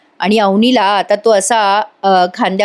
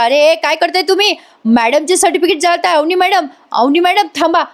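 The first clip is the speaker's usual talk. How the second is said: high, urgent yell to stop them; shouting; 7 semitones higher than usual. Retold or imitated. Raja shouts through the window to stop the burning, high and urgent. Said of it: "मोठ्याने ओरडतो राजा".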